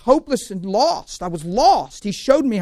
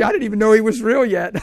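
ordinary talk, nothing marked

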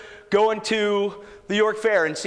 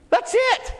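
high-pitched preacher's outburst; emphatic exclamation. Real, not acted. Preacher capping the commission for the church, high emphatic cry. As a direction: cry it out as the final word. Climax of exhortation, cried out.

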